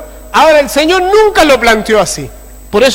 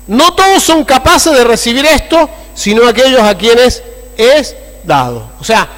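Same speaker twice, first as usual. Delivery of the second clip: normal talking voice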